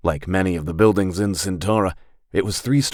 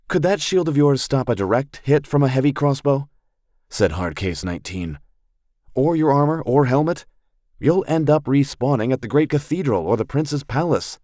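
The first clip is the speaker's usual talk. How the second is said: put-on higher, quick, challenging young woman; Hardcase's voice, sharply raised; 8 semitones higher than usual. A narrator's reading. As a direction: needle the dwarf with pointed questions, voiced higher.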